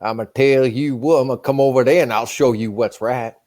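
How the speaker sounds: a southern tough talker's low threat; southern accent. Acted. Answering the friend's challenge, southern accent. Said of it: "making fun of the southern accent".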